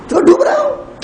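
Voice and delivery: high questioning jab; high teasing question; mock surprised. A narrator's reading. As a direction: echo his words back in surprise. Jesus asks mockingly.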